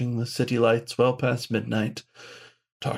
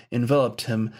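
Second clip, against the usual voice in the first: ordinary delivery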